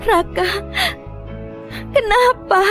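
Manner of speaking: tearful. Acted. Calls missing husband, crying.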